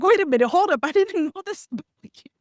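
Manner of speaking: high, loud startled double take with a laugh; surprised. Retold or imitated. Host recalling learning a surprising fact about her guest, laughing in surprise. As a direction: catch yourself off guard, laughing in disbelief. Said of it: "I was like".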